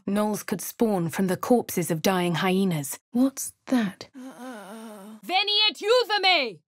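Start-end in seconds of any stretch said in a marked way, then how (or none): 3.1-4.1 hushed, breathy question; wary whisper. Acted. 5.2-6.6 high, loud spell cry; shouted incantation. Acted. Casting a spell aloud.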